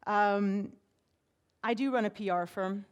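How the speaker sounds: usual speaking voice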